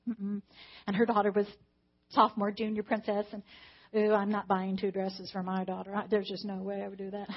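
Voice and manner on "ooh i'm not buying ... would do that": mock snooty. Mocking her own past judgment.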